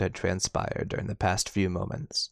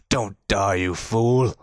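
shouting; slower than usual. A narrator's reading. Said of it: "he shouted".

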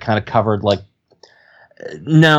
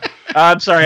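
laughing; 7 semitones higher, 4 dB louder, faster than usual. Real, not acted. Laughs at own voice.

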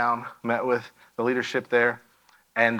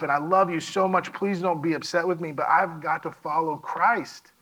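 overwhelmed outpouring; 6 semitones higher than usual. Retold or imitated. Son pleading with his parents, overwhelmed and urgent. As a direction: pour it all out, pleading. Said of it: "I'm just talking a mile a minute".

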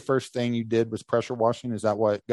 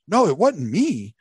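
mock denial; 6 semitones higher, 4 dB louder than usual. Dan denying in retold story.

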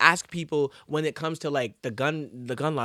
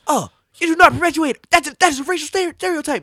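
much higher and louder, preachy cartoon voice; hammed-up cartoon scold, pitched way up; extra PC Principal; 12 semitones higher, 8 dB louder than usual. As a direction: lecture like an over-the-top PC bro. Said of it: "he's super extra like that".

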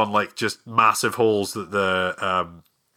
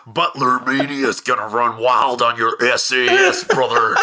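laughing wrestler promo, louder; Hulk Hogan voice; 3 dB louder than usual. Retold or imitated. Butler mocking the SAS soldiers, in a laughing Hulk Hogan voice. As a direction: ham up a pumped wrestler taunt. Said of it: "when I put the Hulk Hogan voice on".

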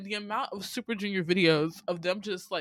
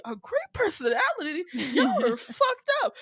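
high-pitched disbelief rising into laughter; incredulous outrage; 13 semitones higher, 3 dB louder than usual. Real, not acted. Host reacts to MCs praising during a cruel prank, incredulous. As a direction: throw the phrase back high, appalled and amused.

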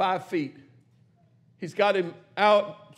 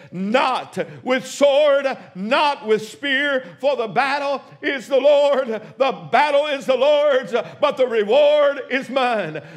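loud, lifted sermon delivery, stretching key phrases; rousing preaching; 3 semitones higher, 4 dB louder than usual. Acted. Pastor voicing David's victory cry to the congregation, loud and lifted. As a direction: preach it with mounting fire.

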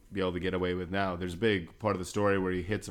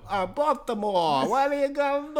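mock Baltimore accent; 4 dB louder than usual. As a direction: badly fake a Baltimore accent, squeaked high. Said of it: "how to do a Baltimore accent".

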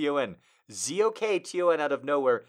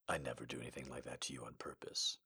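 low, fast whispered plea; 12 semitones lower, 20 dB quieter, faster than usual. Acted. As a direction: hush it close to her ear. Whispered retake of line.

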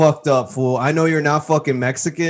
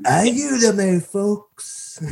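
much higher, drawn-out caricature; mock Donald Trump as Speedy Gonzalez; 9 semitones higher, much slower than usual. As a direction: mimic a muddled cartoon impression. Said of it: "Donald Trump doing Speedy Gonzalez quoting Porky Pig".

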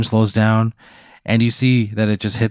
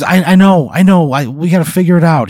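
loud, high, frantic stammer; freaking out; 9 semitones higher, 7 dB louder than usual. Acted. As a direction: blurt it out in panic. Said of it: "Jim Gums starts freaking out".

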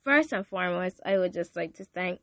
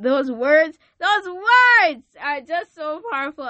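higher and louder, bursting with feeling; overcome with emotion; 8 semitones higher, 12 dB louder than usual. Real, not acted. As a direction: let the passion spill out. Overwhelmed by the words.

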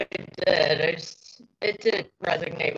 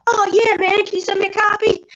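much higher, louder mock eagerness; mock fan reply; 13 semitones higher, 5 dB louder than usual. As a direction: play up a cheesy fan answer. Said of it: "I'm like".

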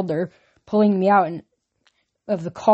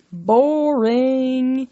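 a bored, higher drawn-out sigh of a word; bored dismissal; 6 semitones higher, 3 dB louder than usual. A narrator's reading. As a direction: dismiss it like it's beneath you. Aphrodite dismisses the quest.

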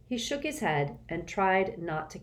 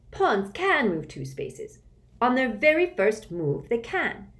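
smug know-it-all; 3 semitones higher, 4 dB louder than usual. A narrator's reading. Smug boy mocking classmate.

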